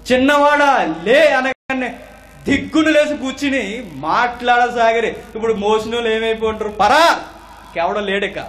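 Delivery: high, loud, excited sermon storytelling; full-force preaching. Acted. Preacher retells the dead boy sitting up, full-force preaching. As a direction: drive the miracle home to the crowd.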